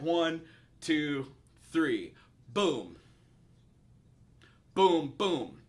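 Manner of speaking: vocal drum beat. Shown as a demonstration. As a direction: sing the drum hits with your mouth. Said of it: "I'll break it down".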